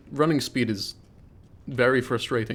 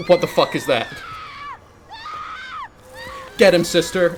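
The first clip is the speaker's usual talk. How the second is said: louder mock panic yell; hammed-up shout; 3 dB louder than usual. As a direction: yell it like a scared streamer. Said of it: "back in character".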